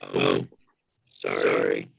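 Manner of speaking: glitchy low robotic voice; distorted robot voice over a bad line. Real, not acted. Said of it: "Your voice is messed up".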